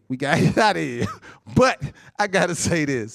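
normal talking voice

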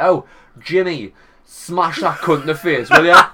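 rallying shout. Pretend. Urging Jimmy to hit an MP, loud rallying shout. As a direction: rouse your thug to attack. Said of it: "I'll be like".

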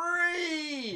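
high, loud cry of relief; joyful cry. Retold or imitated. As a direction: shout it with sudden joy.

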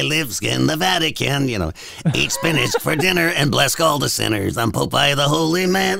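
lightly voiced Popeye; a hint of the cartoon sailor. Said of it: "My Popeye sucks these days".

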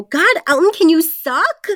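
high, exasperated character quote; mock scolding. Quoting the movie girl scolding Elton, high and mocking.

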